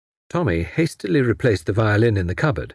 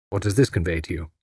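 plain reading voice

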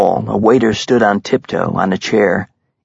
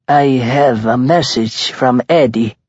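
put-on measured, thick Italian accent; 4 semitones higher than usual. A narrator's reading. Hitman announcing his message to the mob boss, thick Italian accent. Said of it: "in a thick Italian accent".

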